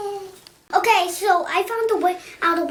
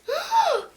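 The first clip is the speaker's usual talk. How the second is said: surprised gasp. Acted. Box trick reveal.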